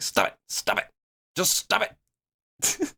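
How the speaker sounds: gently shushing a fussy infant, lightly imitated; soothing a crying baby. Imitating the singer hushing a crying baby, a mock lullaby coo. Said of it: "like he's trying to get a baby to stop crying".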